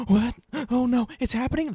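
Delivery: gasping, high-pitched mock panic; imitated shocked gasp. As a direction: mimic a crowd gasping in sudden alarm. Imitating a shocked theater audience.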